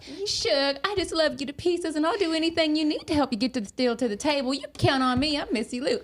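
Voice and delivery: sweet Southern belle talking to a client. Retold or imitated. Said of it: "I click into it".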